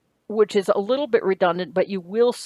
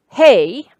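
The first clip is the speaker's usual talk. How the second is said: hey called out loud like a greeting; teacher calling out hey; 4 semitones higher, 11 dB louder than usual. Shown as a demonstration. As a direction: shout the hey as a memory hook. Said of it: "as in hey".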